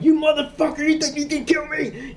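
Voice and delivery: high, snarling Chucky-style threat; menacing possessed doll; killer doll voice. Retold or imitated. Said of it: "and go like".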